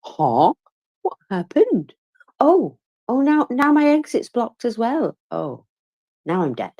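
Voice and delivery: clueless lemon. Pretend. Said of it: "like an absolute lemon going".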